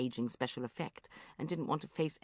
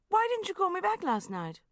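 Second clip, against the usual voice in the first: reproachful; 14 semitones higher, 4 dB louder than usual. Acted. Reproachful question.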